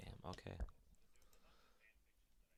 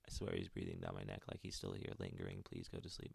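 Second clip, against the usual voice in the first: a much lower, hushed mother's confession; low confessing mother; 12 semitones lower, 9 dB quieter, faster than usual. Acted. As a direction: read the note low and quiet, like a guilty secret. Mother's note continues, low.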